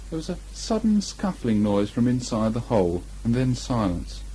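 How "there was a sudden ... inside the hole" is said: plain reading voice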